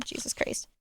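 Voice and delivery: breathy whispered Jesus Christ; whispered aside. Real, not acted. Streamer sighing to herself after advising kids, whisper. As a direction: let it out under your breath.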